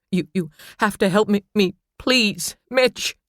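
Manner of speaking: high desperate begging; frantic plea. A narrator's reading. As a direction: beg him, near tears.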